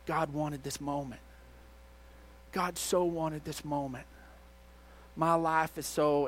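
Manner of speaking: quiet, subdued imitation of a crying man; hushed and halting, with long pauses; retold tearful confession. Crying man confiding in the pastor, understated sorrow. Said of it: "he's just crying".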